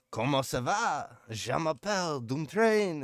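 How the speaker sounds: put-on high, slow, loud mangled French accent; hammed-up beginner's French accent; bad French accent. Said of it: "this accent is killing me".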